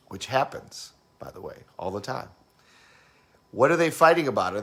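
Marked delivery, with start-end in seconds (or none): none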